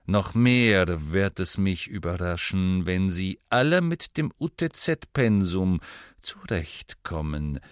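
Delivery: slow, drawn-out lecturing with loud stressed openings; condescending schoolmaster. Acted. Snape lectures his class on exams, condescending. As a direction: stretch words disdainfully, sinking at the end.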